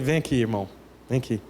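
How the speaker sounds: mock pious talk to a friend. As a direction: talk to a friend like a stiff prayer. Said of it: "você fala assim com ele".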